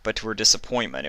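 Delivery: plain reading voice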